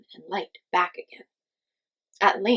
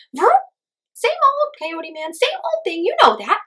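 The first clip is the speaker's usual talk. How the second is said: very high, loud chirpy bird voice; high-pitched hummingbird; 13 semitones higher, 10 dB louder than usual. A narrator's reading. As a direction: whoop and chirp like a tiny bird. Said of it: "a high-pitched voice".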